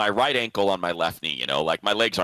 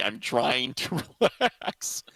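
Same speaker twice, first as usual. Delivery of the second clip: laughing apology; 3 semitones higher, slower than usual. Retold or imitated. Retelling own reply to nurse.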